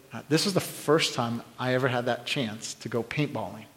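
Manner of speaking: ordinary talk, nothing marked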